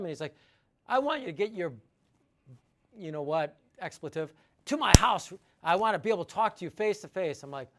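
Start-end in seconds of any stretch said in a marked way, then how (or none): 0.9-7.4 retold angry customer, rising to a loud demand at "to my house". Irate client ordering the owner to his house, mock yell.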